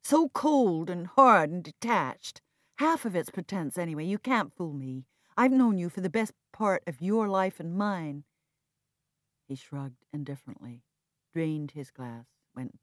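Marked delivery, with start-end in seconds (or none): 0.0-8.2 loud, hard accusing voice; harsh reproach. Acted. Viv accusing Jack of pretense, harsh and loud. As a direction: cut into him, harshly. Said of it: "surprised at the harshness of my voice".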